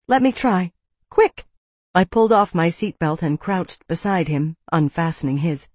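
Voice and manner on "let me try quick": high, jumping higher on the last word; frantic plea ending in a sharp command; urgent insistence. A narrator's reading. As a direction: press him to act at once. Pressing him to act before capture.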